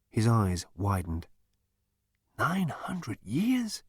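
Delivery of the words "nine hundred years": hushed, slow, disbelieving whisper; breathed whisper. A narrator's reading. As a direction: breathe it out in shock. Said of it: "he breathed to himself".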